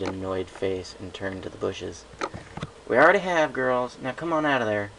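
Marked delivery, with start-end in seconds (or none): 2.9-4.9 calling out. Acted. Calling into the bushes.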